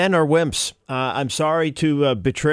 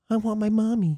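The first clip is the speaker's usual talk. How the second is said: put-on whiny little kid voice; whiny baby voice; 6 semitones higher than usual. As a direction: whine it like a needy child. Mocking men as babies.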